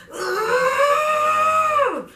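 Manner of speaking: long, high, straining yell; re-enacted roar of one huge push; loud drawn-out birthing scream; Hulk-like pushing scream. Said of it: "I had to turn into the Hawk".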